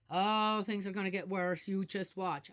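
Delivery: loud, ominous gloomy warning; lightly voiced pessimist elder; mock doomsayer. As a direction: ham up a grumpy elder predicting doom.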